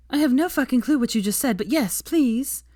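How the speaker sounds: eager. Acted. Veronica melting, eager.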